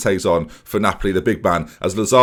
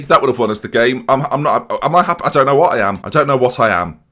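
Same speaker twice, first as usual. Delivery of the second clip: usual speaking voice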